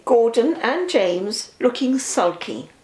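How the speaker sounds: ordinary delivery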